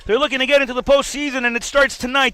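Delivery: loud, high, fast sports hype; hyped commentator. Acted. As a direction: sell the big matchup.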